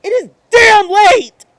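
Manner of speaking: despairing outburst; building to a shout. Acted. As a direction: let it break into a yell on damn.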